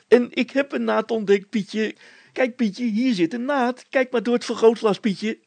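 put-on young man showing off his find; eager young Hannes. A narrator's reading.

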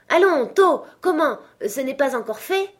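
high, loud hurrying call; impatient jovial urging. A narrator's reading. Fezziwig hurrying apprentices with the shutters, loud urging. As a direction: chivvy them along loudly.

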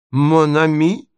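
high, incredulous echo; indignant, sharply rising pitch; enraged cry. A narrator's reading. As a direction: throw it back as an outraged question. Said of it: "вскричал он в бешенстве".

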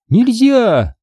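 very high forbidding cry; shrieked command. Acted. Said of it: "завопила Минди". (высокий запрещающий вопль)

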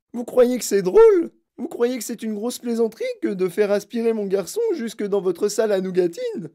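high, loud indignant questions; furious accusation. A narrator's reading. Mother rebukes Wonka for laughing, furious. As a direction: rail at him without letting up.

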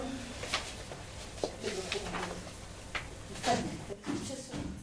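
very slow, soft and half-whispered; gentle reassurance. Acted. Franca calming her apologetic friend, slow and hushed. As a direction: ease his guilt, barely voicing it.

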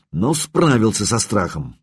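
ordinary delivery

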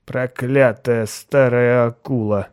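put-on slow slurred drunken mutter; slurred cynical barfly. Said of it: "снова пробормотал он".

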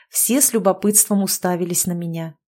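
ordinary delivery, nothing marked

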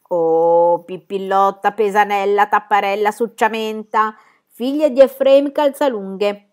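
loud, drawn-out roll call of her names; hearty, slowing sea-captain greeting; booming father. A narrator's reading. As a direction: hail your daughter with gusto. Father booms greeting to his daughter.

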